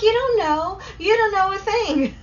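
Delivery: high, loud mock-indignant protest; hammed-up, high-pitched scoff; scoffing retort. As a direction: fire back in exaggerated disbelief. Scoffing retort to lovestruck people.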